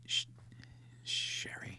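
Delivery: hushed night whisper. Pretend. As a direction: whisper so as not to wake anyone. Nudging partner awake at night.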